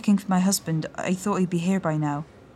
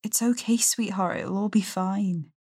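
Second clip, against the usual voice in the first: a touch of soothing, higher mother's voice; gently comforting mother; 12 semitones higher than usual. A narrator's reading. Mother holding her shocked daughter, lightly voiced and soothing.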